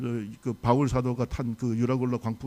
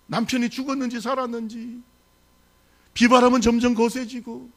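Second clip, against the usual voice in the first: raised, pleading wail of a worried wife; wailing fishermen's wife; 10 semitones higher, 3 dB louder than usual. Acted. Fishermen's wives on the harbor, wailing for their husbands. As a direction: cry out to the dark sea for the missing husbands. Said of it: "아낙네들이 밖에 나가서 통곡을 하는 거예요".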